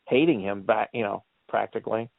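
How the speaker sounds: ordinary talk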